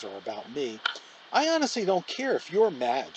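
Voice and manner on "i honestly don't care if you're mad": spiteful and a bit louder. A narrator's reading. Olivia snaps at her boyfriend Justin, spiteful. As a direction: lash out to hurt him. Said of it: "she said spitefully".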